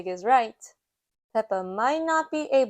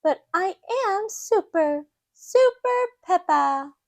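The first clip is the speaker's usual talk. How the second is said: put-on high, louder child voice; high confident piglet; Peppa; 8 semitones higher, 5 dB louder than usual. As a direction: announce it proudly like a small girl. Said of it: "says Peppa".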